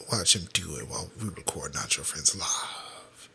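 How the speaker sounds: low whispered aside. Real, not acted. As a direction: whisper like a nature narrator. Whispered commentary.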